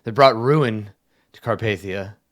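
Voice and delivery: loud, anguished proclamation; emphatic grief, acted without sobbing; tearful king. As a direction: lament it forcefully, near tears in character. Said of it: "his eyes are starting to, like, tear up".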